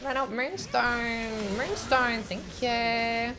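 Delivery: high, drawn-out playful wail; hammed-up high-pitched begging; mock wailing plea. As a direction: plead in a silly high voice, stretching each word. Same playful high voice over the game.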